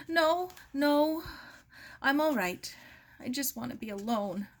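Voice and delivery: put-on a young woman forcing calm after shock; voiced grieving Mary. A narrator's reading. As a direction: push her away, voice high and tight. Mary after news of death.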